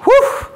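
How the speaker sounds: short, high, loud "whew"; high burst of workout energy; energetic whoop. Real, not acted. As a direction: hoot with the effort of marching. Whoop between marching drills.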